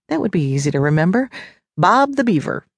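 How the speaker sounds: usual speaking voice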